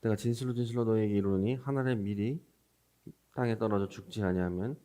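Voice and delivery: ordinary talk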